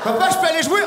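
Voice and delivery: put-on high, eager little kid asking dad; hammed-up child's plea. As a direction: play a small boy begging to go play. French child voiced.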